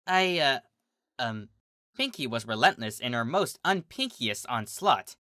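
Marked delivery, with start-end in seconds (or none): 0.1-1.5 hesitant stammer; halting, stuck for words. A narrator's reading. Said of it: "Sweetie stammered".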